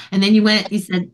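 ordinary talk

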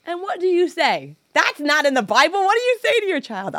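high, loud, helpless questioning; exasperated outburst. Retold or imitated. Exasperated at unanswerable question from child.

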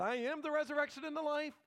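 mock incredulous. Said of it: "Nobody talks like that".

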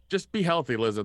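loud call-out. Pretend. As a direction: call it out like a plea to [name]. Calling out to [name].

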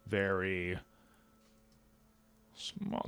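tiny hushed voice; whispering. Pretend. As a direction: drop to a near-silent whisper. Contrast very big vs very small.

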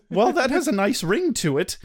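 ordinary talk, nothing marked

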